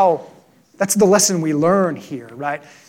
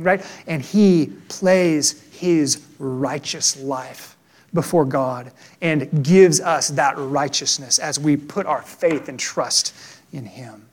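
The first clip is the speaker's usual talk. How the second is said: usual speaking voice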